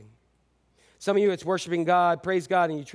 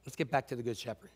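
gentle coaxing; 3 semitones lower, 8 dB quieter than usual. Pretend. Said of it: "We need to say".

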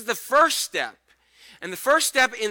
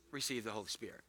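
much lower and softer, almost breathed; hushed; 9 semitones lower, 18 dB quieter, faster than usual. Acted. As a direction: breathe the words gently onto them. Jesus breathing the words.

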